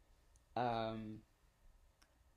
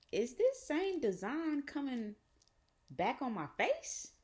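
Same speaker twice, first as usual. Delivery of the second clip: high-pitched, drawn-out disbelief; slowly rising, high questioning voice; incredulous; 14 semitones higher, slower than usual. Retold or imitated. Telling viewers what they saw in the mirror, incredulous.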